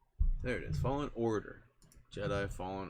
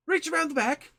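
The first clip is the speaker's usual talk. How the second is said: exasperated, high and loud tech support; hammed-up frustrated help-desk worker; imitating fed-up IT guy, loud and high; Roy; 10 dB louder than usual. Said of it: "how frustrated he gets".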